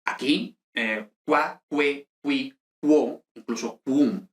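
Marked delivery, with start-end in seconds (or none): 1.2-4.3 "qua, que, qui, quo" as kwa, kwe, kwee, kwo; "qua, que, qui, quo, quum" separated, u always heard; sounded U. Shown as a demonstration. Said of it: "la U siempre suena".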